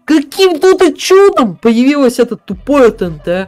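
furious yelling in disbelief; angry shout. Real, not acted. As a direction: blow up at the screen. Streamer rages at trap explosion. (злой крик)

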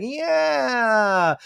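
put-on high little-kid yeah; a tiny child agreeing; toddler voice. Toddler Summer Moon chirping a high little yes. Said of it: "Summer's like".

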